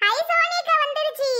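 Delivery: high-pitched excited cry of relief; delighted announcement. Acted. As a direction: announce a long-awaited arrival with glee. Announces the mother's long-awaited arrival.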